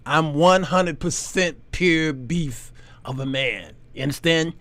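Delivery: hint of a macho growl; macho declaration. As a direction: puff up and go low. Deep tough-guy macho voice.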